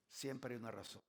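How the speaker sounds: said low and hushed, almost to himself; soft repeat. Acted. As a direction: drop the voice to let the point sink in. Preacher softly repeats key point. (repetición suave y baja)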